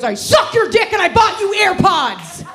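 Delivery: high, loud comeback yell; imitated angry shout. Girlfriend shouts back at arguing boyfriend, loud mock yell. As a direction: shout it back as an outraged retort. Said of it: "she shouted back".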